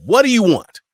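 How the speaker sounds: high, loud demand; demanding question. Acted. Acted contrast to a low voice.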